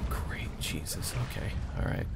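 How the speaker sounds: under-breath whisper. Real, not acted. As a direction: whisper it so nobody hears. Sees a threat, drops voice.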